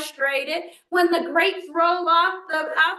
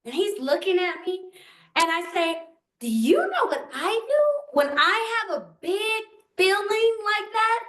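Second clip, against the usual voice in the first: higher, soothing voice for a little one; gentle to toddler; 5 semitones higher than usual. Retold or imitated. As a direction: coax him out from under the table. Grandmother soothing upset toddler.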